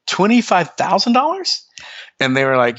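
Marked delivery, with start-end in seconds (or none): 0.1-1.7 high, incredulous rising question; retold shock. Quoting his shock at a label quote, high and incredulous. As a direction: act floored by the price.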